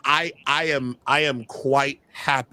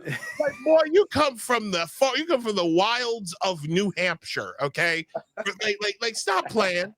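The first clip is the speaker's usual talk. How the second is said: fake southern preacher accent; 6 semitones higher than usual. Host mocking a politician's put-on Southern accent. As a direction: ham up a Southern preacher lecturing a New Englander. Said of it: "fake southern accent where I'm really trying to".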